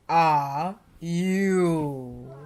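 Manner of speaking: loud, booming giant; thundering giant. A narrator's reading. Giant demanding who the tiny intruder is, booming. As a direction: boom it out like a giant. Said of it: "thundered the giant".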